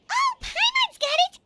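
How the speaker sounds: high, bright burst of eureka; thrilled she figured it out; excited realization. Acted. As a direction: jump up with a sudden idea. Paimon thinks she solved it.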